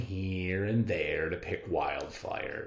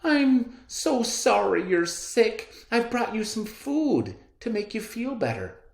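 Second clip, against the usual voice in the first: sympathetic; 7 dB louder than usual. Acted. Girl comforting sick grandmother, high sympathetic voice. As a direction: coo it kindly to the sick old lady. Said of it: "with sympathy in her voice".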